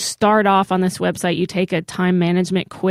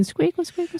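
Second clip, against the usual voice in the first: squeaky wheel noise; 9 semitones higher, 7 dB quieter than usual. Pretend. Mimics dolly wheel squeaking.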